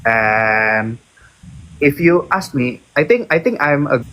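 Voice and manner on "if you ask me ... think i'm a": normal talking voice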